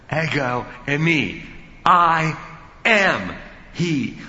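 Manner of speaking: EGO EIMI, I AM HE, each word loud and set apart; forceful declaration, hitting I and AM hardest; emphatic. Shown as a demonstration. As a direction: proclaim I AM at full force, high and loud. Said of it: "To state that emphatically like that".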